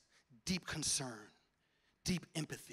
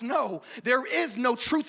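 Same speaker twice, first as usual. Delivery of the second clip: ordinary talk, nothing marked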